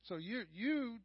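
ordinary talk, nothing marked

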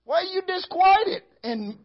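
psalmist chiding his soul. Retold or imitated. Psalmist questioning his own soul, high and pleading. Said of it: "He said".

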